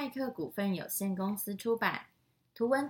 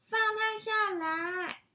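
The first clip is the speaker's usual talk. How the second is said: high, drawn-out shout; loud shout; 13 semitones higher, 6 dB louder, slower than usual. Acted. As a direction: yell it after the dog, slow. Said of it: "小秋大声喊着". (大声拖长地喊)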